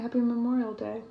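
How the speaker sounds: ordinary delivery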